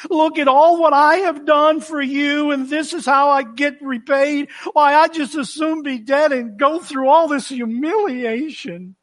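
high-pitched, indignant martyr's whine; sarcastic complaint. Acted. Jonah complaining to God about his repayment, sarcastic. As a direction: play up the wronged servant, pitched high. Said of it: "a sarcastic remark or the way he presents that".

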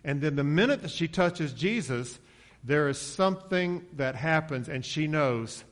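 usual speaking voice

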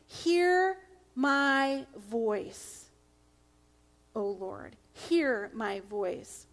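slow, firm, unpleading "Hear my voice"; "Hear" drawn out slowly and firmly, no begging; confident demand. Shown as a demonstration. Pastor shows a confident demand to God, slow and firm. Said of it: "There's no begging".

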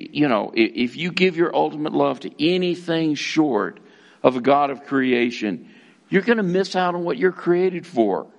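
normal talking voice

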